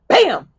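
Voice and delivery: loud, high mock bang; hammer bang. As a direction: shout the bang as you hit. Hammer hitting the wall.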